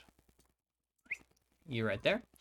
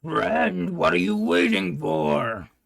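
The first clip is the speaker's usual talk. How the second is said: slow, loud, higher monster voice; creature voice; 4 semitones higher, 7 dB louder, much slower than usual. Acted. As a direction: draw out a loud creature plea. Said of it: "The creature says".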